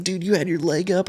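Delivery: high, amused jab at his buddy; ribbing him about the jump; excited teasing. Retold or imitated. Buddy teasing him about his leg, excited.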